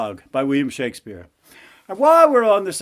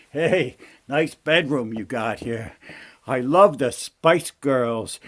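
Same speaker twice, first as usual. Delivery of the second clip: wisecracking frog; 2 semitones lower than usual. Acted. Frog admires princess's bedroom, wisecracking frog voice.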